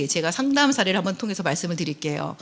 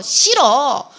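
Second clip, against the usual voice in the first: put-on high, whiny teen brushing off a parent; sulky teenager; 6 semitones higher, 4 dB louder, much slower than usual. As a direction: play the irritated teenager shutting the door. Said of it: "이런 얘기를 하는 아이들이".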